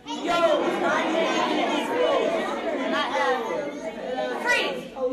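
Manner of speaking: loud, slow, swaggering rapper intro; rapper voice demo. Shown as a demonstration. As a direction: strut into your rapper name. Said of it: "Decide what kind of voice your rapper has".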